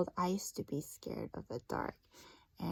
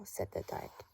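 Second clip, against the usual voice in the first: hushed, breathy storybook whisper; whispering the dark's words to first graders; spooky whisper; 13 semitones lower, 8 dB quieter than usual. Acted. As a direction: whisper it like a secret. Narrator whispers the dark's tag.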